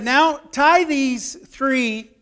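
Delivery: slow, loud mock-angry order; retold furious king. Storyteller as angry king ordering men bound, slow mock-stern command. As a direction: give a stern order, dragging each word. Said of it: "the king got furious mad".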